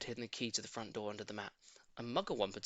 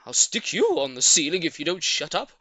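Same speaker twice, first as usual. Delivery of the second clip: louder, irritated threat; snapping; 4 semitones higher, 10 dB louder than usual. A narrator's reading. Mugglewump threatening his small son, irritated snap. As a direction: snap back at the cheeky child. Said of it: "snapped Mugglewump".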